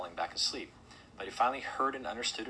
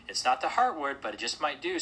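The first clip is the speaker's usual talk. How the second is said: lightly voiced, higher character speech; Mister Hartwood's voice; 6 semitones higher, 6 dB louder than usual. A narrator's reading. Mister Hartwood nods at the lit tree, raised character voice. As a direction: lift pitch and volume a little for the character.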